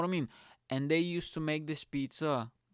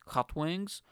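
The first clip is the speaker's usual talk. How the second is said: ordinary delivery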